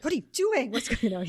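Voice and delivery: a hammed-up startled what are you doing; shocked husband. Husband shocked at wife drinking.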